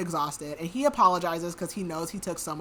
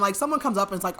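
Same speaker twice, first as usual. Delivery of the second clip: normal talking voice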